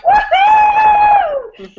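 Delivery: very high, loud woohoo; a wild, soaring cheer; morning scream. Retold or imitated. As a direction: shout it out like jumping out of bed. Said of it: "I scream and I'm like".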